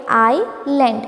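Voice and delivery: આઇલેન્ડ said slowly as "eye-land", no S; silent S. Shown as a demonstration. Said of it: "silent છે".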